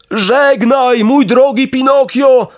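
high-pitched dog voice, calling; dog's reply. A narrator's reading. Grateful bulldog answers Pinokio from the shore, high calling voice. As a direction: call back high across the water. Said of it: "odrzekł pies".

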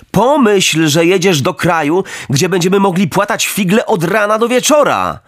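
put-on high, breezy boyish voice; cheeky boy Knot. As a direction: toss it off like a carefree kid.

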